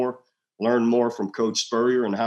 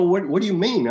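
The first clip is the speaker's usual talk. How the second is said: higher, baffled question; retold confusion; 8 semitones higher, 4 dB louder than usual. Husband baffled by his wife's accusation. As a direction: act like the news is a total surprise. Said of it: "I go".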